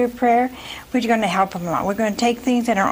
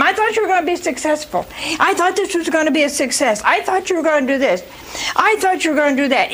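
fast, higher-pitched nagging spouse; imitated nagging; 6 semitones higher, faster than usual. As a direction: pile on complaints like a nagging companion. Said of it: "who keeps nagging all the time".

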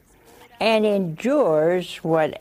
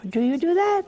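pitched up high in teasing disbelief; mock incredulous; 10 semitones higher, faster than usual. Preacher teasing the congregation, high mock disbelief. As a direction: ask it like you can't believe it.

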